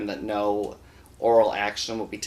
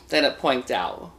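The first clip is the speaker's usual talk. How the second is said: usual speaking voice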